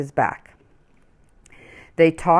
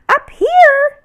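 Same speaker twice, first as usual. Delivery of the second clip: much higher, louder bird call; tiny bird voice; 9 dB louder than usual. Acted. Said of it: "said the voice".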